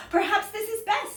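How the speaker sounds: hopeful about the nest; polar bear in a nest. Acted. Polar bear settling into a nest, hopeful and high. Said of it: "said the bear from a nest".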